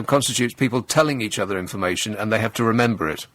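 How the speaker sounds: ordinary talk, nothing marked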